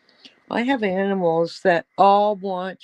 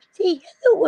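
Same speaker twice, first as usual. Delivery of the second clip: much higher sing-song pet talk; high cooing voice urging the puppy to greet viewers; coaxing pet voice; 5 dB louder, faster than usual. Real, not acted. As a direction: coo at the puppy to greet everyone.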